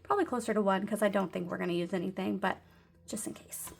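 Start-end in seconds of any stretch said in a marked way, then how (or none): 3.1-3.7 hushed little whisper. Real, not acted. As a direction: drop to a whisper. Whispered aside while budgeting.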